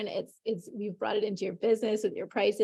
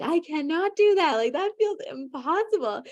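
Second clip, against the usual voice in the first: higher and louder, alarmed protest; panicked refusal; 8 semitones higher, 5 dB louder than usual. Retold or imitated. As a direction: recoil from the idea of raising prices. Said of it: "And you were like".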